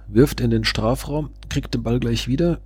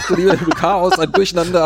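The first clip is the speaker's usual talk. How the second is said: high-pitched, half-laughing commentary; real excited alarm, pitched up; flustered excitement; 6 semitones higher, 3 dB louder than usual. Real, not acted. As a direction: call the goalmouth scramble with voice raised. Goalmouth chaos, live commentary.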